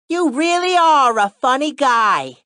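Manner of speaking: put-on slow, high, lightly done Italian accent; playful mock gangster line; bad Italian accent. Waitress joining the joke in a bad Italian accent. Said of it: "she said in a bad Italian accent".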